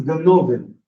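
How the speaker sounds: "ganovin" stressed on NO, with a full o; shifted penultimate stress. Shown as a demonstration. Teacher giving the plural, "ganovin" stressed on NO. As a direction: hit the middle syllable of "ganovin". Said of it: "there is a shift in stress".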